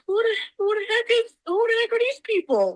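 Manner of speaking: high, fast startled question; retold alarm, pitched high. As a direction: catch strangers walking through your kitchen. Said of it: "and I'm like".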